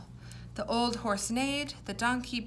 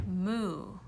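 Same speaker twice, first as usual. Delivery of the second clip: cow moo. Shown as a demonstration. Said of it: "I am going to say the sound an animal made".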